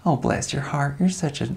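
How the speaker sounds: a genteel Southern lady, soft and dignified. Shown as a demonstration. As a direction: bless them sweetly, Southern belle style. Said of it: "there's the Southern genteel".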